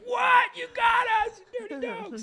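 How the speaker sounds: very high squealed mock shout; shrieking kid imitation. Dad imitating pranked kids at the reveal, shrieking. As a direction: squeal it like an excited child, far above your voice.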